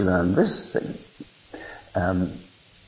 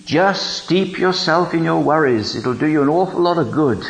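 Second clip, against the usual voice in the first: mock voice; 7 semitones higher than usual. Mock voice from the throne.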